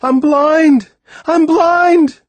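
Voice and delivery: high, loud panicked scream; a boy shrieking in panic; screaming in terror. A narrator's reading. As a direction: scream it out, realizing he's blind. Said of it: "I screamed to Timothy".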